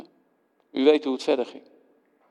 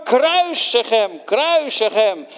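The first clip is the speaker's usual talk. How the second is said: slow, louder mob chant; crowd shouting; 7 semitones higher, 3 dB louder, slower than usual. Acted. The mob chants for crucifixion, slow and loud. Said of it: "En nu was het".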